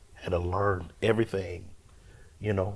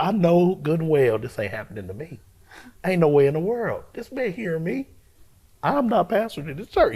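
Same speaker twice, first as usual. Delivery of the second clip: higher, louder retold protest; indignant refusal; 9 semitones higher, 5 dB louder than usual. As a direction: dig in and refuse emphatically. Acted refusal to pastor the church.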